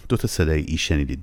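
ordinary talk

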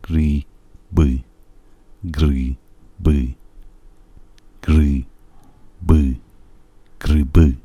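'گریب' with a plain first i and a thick second one; careful pronunciation. Shown as a demonstration. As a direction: say 'گریب' with two different i sounds. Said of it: "ای اولش یه ساده است، یه دومش غلیظه".

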